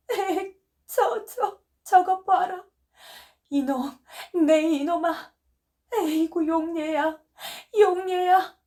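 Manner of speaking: high, loud, slow desperate cries; desperate slow wailing. Acted. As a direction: wail for help as if your child is dying. Wife screams for help. (높고 크게 느리게 살려달라는 울부짖음)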